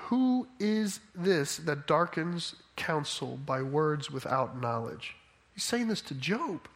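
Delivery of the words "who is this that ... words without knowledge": solemn reading. Acted. Reading God's words, slow, low.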